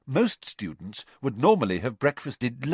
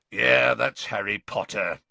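put-on gruff growling old auror. A narrator's reading. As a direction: growl it from behind. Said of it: "said a growling voice".

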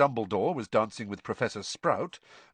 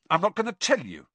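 scornful, higher and pointed; scathing; 8 semitones higher, 4 dB louder, faster than usual. A narrator's reading. Ron refusing to explain to Hermione, scathing. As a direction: throw it out with scorn. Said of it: "said Ron scathingly".

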